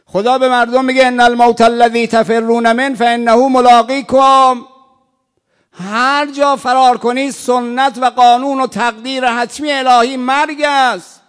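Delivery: loud, high declamation of the verse; full-force pulpit proclamation; fiery preaching. Acted. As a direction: thunder the verse at the congregation.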